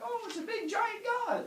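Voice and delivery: very high put-on crowd voice; amazed humans. Humans spot the giant god, high amazed voice. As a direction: squeal it in awe.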